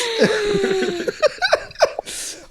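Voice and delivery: high-pitched real laugh; long, high burst of laughter. Real, not acted. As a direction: crack up at the teasing. Laughing at teasing about accent.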